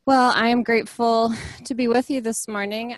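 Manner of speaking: normal talking voice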